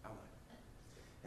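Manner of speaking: breathless stunned whisper; a shocked man's hushed gasp of words. Retold or imitated. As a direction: whisper it in disbelief. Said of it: "he was just like".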